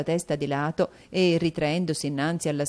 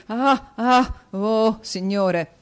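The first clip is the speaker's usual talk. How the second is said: odd laughter; 5 semitones higher, 7 dB louder, much slower than usual. A narrator's reading. Newman's odd outburst.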